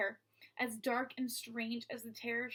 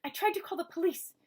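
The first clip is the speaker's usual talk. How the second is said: higher hushed worried woman; whispered; 6 semitones higher, 5 dB louder than usual. Acted. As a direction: whisper it close. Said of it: "she whispered in Maggie's ear".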